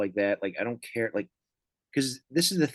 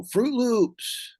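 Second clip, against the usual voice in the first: yelling out; 11 semitones higher than usual. Retold or imitated. Comic imitating a heckler yelling from the crowd, mock yell. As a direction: blurt it out like a random heckler. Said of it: "just yelling out things".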